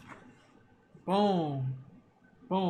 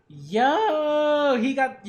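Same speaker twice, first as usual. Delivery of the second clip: excited yo; 8 semitones higher, 6 dB louder, much slower than usual. Real, not acted. Hype continues after shout.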